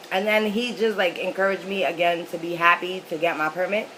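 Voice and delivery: ordinary delivery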